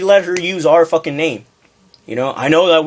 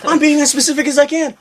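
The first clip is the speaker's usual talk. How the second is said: high-pitched imitation of an outraged customer; retold angry yelling; 12 semitones higher than usual. Costume customer yells at the clerk, mock outraged yelling. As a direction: act out her yelling, pitched far up. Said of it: "She starts yelling at me like".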